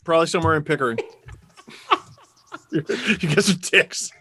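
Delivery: ordinary talk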